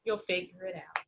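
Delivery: soft, hushed parental murmur; whispered. A narrator's reading. Parents kissing their curious child, soft whisper. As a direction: say it gently under the breath, like a secret. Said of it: "They kissed her and whispered".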